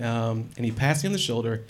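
usual speaking voice

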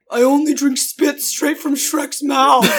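slow, odd creepy voice; mock creepy Shrek fan. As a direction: play a weird obsessed fan. Joking gross tourist quote.

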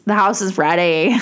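eerie haunted-house voice; spooky voice. Retold or imitated. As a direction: say it like a creepy ghost. House is evil, spooky line.

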